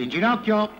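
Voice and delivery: loud stern order to kneel; commanding shout. Acted. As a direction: bark it down to his knees.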